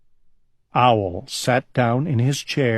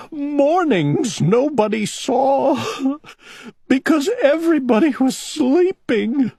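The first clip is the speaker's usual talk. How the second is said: a storybook owl weeping, slowed and pitched up; sobbing; 13 semitones higher than usual. A narrator's reading. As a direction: cry the words out through sobs. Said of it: "sobbed Owl".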